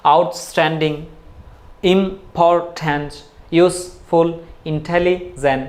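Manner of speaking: each word, like "ইম্পর্ট্যান্ট", broken into separate syllables. Shown as a demonstration. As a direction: count out the beats in "আউটস্ট্যান্ডিং" and "ইউজফুল". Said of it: "দুইটা বা তিনটা বা চারটা সিলেবেল". ("ইম্পর্ট্যান্ট"-এর মতো প্রতিটি শব্দ সিলেবল ভেঙে বলা)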